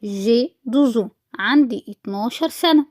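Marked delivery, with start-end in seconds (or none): 0.0-1.1 very slow, every syllable clear; slow model answer for learners; slow reading aloud. Shown as a demonstration. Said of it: "رد قال إيه؟". (قراءة بطيئة واضحة)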